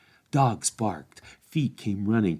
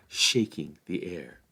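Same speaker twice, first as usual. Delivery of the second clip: plain reading voice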